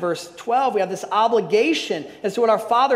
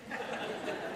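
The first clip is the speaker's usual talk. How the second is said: soft laugh; 16 dB quieter than usual. Real, not acted. Laughs at own joke.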